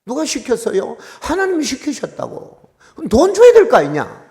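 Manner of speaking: loud, high, furious complaint to God; angry shouting prayer. Retold or imitated. Pastor shouting at God over unpaid wages, angry. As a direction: yell your grievance at God. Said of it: "소리를 한 삼십 분 질렀더니".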